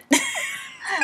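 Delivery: laughing hard, high-pitched; high burst of laughter. Real, not acted. Laughs at the flubbed line.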